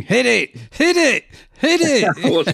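imitating a fan yelling at the striker, laughing; retold fan shout. Podcaster imitating a fan in the clip, mock yelling. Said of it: "there's a guy shouting".

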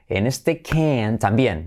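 normal talking voice

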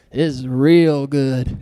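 low, slow Texan drawl; Texan accent. Acted. Preacher voicing God approving creation, Texan accent. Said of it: "said it with a Texan accent".

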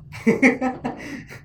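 loud, high laugh; breathy laugh bursting out; burst of laughter. Real, not acted. Laughs after asking a question.

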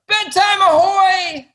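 very high, slow, sing-song octopus cheer. Acted. Author reading the octopus to a listener, high and giddy. Octopus character in read-aloud.

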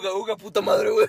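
high goofy caveman voice; mock caveman cursing. Playing cavemen together.

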